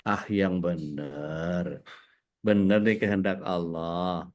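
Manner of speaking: slow, drawn-out teasing challenge; teasing doubt. Real, not acted. Preacher challenges an audience member's answer, teasing doubt. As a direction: stretch the words out, probing the answer.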